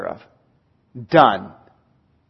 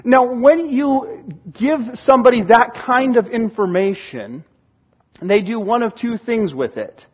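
ordinary talk, nothing marked

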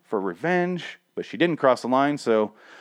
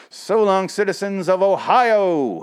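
villain farewell; 9 semitones higher, 4 dB louder, slower than usual. Acted.

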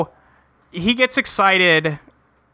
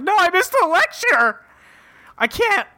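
high-pitched, hammed-up tearful wail; mock crying. Recapping a character who missed the lecture, mock crying. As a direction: wail it as a comic sob, ending in a sigh. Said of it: "we do cut to Jerry crying".

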